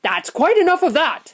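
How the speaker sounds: high, loud, firm shopkeeper; firm command. A narrator's reading. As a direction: cut the crowd off firmly. Shopkeeper breaks up the crowd.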